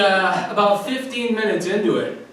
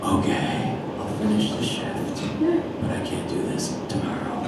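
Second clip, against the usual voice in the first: soft, nearly voiceless giving in; acted weary reluctance; 10 semitones higher, 7 dB quieter than usual. Mall Santa agreeing to finish the shift, wearily hushed. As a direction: concede under your breath.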